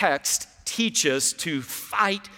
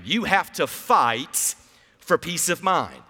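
slow call and response. Shown as a demonstration. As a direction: say it slowly with me, word by word. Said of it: "Say that with me".

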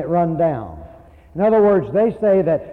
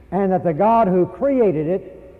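ordinary delivery

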